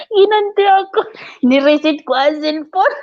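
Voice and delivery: high, laughing gloat, a bit slow; gleeful. Real, not acted. Gleeful boast to her friend about getting even. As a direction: brag through a grin.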